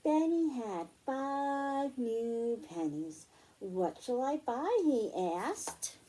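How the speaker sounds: bright storybook read-aloud; reading to children. Acted. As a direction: read it like story time for little girls. Reads picture book to children.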